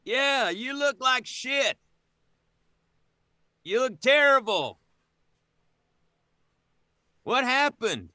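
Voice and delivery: very high, long-distance call; yelling down. Acted. Player as Jens calls to ailing Heliodor, yelled. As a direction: holler each phrase across the distance. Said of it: "you'd have to yell down".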